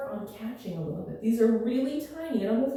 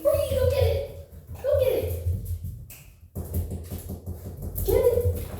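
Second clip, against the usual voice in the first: excited dog cheer; 13 semitones higher than usual. Real, not acted. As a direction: hype the dog up to fetch. Urging dog to fetch.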